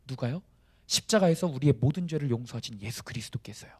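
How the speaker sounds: ordinary delivery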